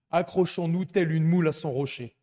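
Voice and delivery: loud, rousing proclamation; proclaimed loudly. Acted. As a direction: call it out to the congregation. Proclaims the motto.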